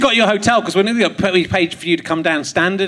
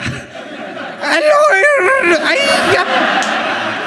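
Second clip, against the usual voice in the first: very high, strained mock Glaswegian; hammed-up Glaswegian greeting, pitched way up; much slower than usual. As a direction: caricature a Glaswegian in a squawking high voice. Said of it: "this is how I'd have done the Glaswegian guy".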